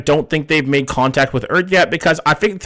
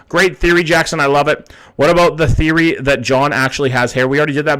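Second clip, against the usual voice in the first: normal talking voice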